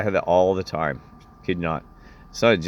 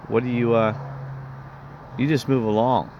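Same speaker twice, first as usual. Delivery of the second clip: retold annoyed woman, voice raised in pitch; mildly mad; 5 semitones higher than usual. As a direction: imitate her irritation, pitched higher. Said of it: "she gets mad about it".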